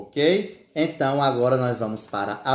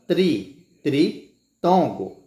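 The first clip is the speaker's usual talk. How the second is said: "tri" alone, then "tritongo" slowly split; segmented term; 2 semitones higher, much slower than usual. Shown as a demonstration. Teacher linking three vowels to the term, slow and segmented. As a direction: isolate "tri" before completing "tritongo".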